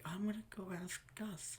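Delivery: timid waitress. Retold or imitated. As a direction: imitate a nervous waitress going to the boss. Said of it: "everyone was so terrified of doing anything".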